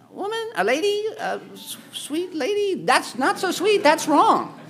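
high-pitched, incredulous, hammed-up scolding; mock rebuke to a neglectful mom. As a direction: squeal at her in mock disbelief.

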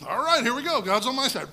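loud, high, eager charge; a fearless shepherd boy rushing in; here we go. Retold or imitated. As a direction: launch into it with total confidence. David charging Goliath.

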